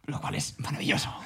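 breathy whisper of longing for a bed. Real, not acted. Co-host whispers dreamily about a bed, hushed.

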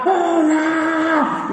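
high, long drawn-out whoop; sustained high mock shout; imitated excited scream. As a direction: mimic an overexcited woman yelling a greeting. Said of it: "la señora gritaba".

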